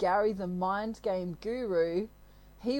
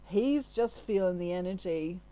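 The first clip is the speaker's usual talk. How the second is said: usual speaking voice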